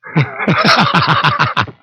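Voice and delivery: long exhale of boredom; heavy tired sigh; long weary sigh. Real, not acted. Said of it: "I believe my exhale said it all".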